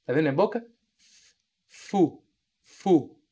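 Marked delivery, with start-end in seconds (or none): 1.7-3.2 푸를 윗니를 아랫입술에 대고 발음; Portuguese-style f. Shown as a demonstration. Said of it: "a gente tende a colocar esse lábio aqui no dente de cima".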